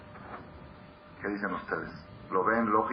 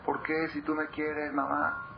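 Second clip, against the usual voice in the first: a hint of a small child's plea, higher; child begging mother, mildly acted; lightly voiced pleading child; 7 semitones higher, slower than usual. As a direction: ask mother why, childlike. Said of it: "el otro decía".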